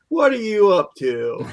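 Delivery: drawn-out suspicious grandpa; slowly, knowing what he's after; mock-suspicious question to a scheming grandson. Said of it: "I'm going".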